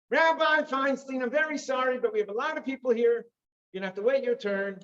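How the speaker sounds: high-pitched imitation of a receptionist aunt. Aunt telling the famous rabbi to wait, high receptionist voice. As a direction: pitch it up high, drawing out the opening address.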